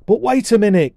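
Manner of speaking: put-on high, loud boy's protest; urgent cry. A narrator's reading. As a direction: cry out to stop him. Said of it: "cried Colin".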